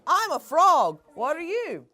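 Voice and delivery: loud, bright frog character calling out; a cheerful frog's loud hello. Acted. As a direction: greet like a bouncy frog. Frog character greets loudly.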